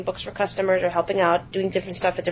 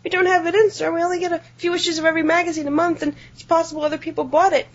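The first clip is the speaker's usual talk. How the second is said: higher, flustered explaining; shocked clerk; 8 semitones higher, faster than usual. Retold or imitated. Shocked clerk explains to the angry customer, higher and flustered. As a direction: stammer a startled, higher-pitched excuse. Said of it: "And I was shocked".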